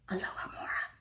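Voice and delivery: soft breathy whisper; whispered spell. A narrator's reading. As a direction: whisper the spell so no one hears. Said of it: "and whispered".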